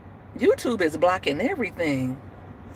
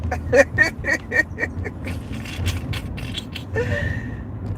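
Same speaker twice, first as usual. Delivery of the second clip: drawn-out high breathy giggle; long high giggle; 11 semitones higher than usual. Real, not acted. Host amused by chat, long giggle.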